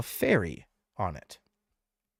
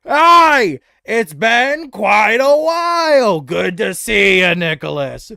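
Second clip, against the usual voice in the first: put-on louder, hearty greeting to an old friend; cheery old friend greeting; 10 semitones higher, 15 dB louder, slower than usual. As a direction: Brood hails the goblin warmly. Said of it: "as Brood".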